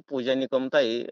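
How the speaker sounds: ordinary talk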